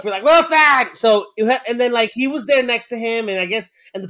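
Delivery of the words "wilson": loud anguished call; Wilson shout. Retold or imitated. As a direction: cry out the name. Cast Away reference shout.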